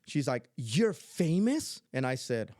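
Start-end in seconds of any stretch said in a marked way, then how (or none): 0.6-1.8 drawn-out, higher incredulous question; astonished granddaughter. Retold or imitated. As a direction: imitate a child's amazed question, rising at the end. Granddaughter's amazed question, retold.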